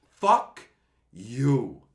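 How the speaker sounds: loud curse with a pause between words; angry outburst. Real, not acted. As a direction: explode on the first word, hold, then land the second. Cursing at end of angry rant.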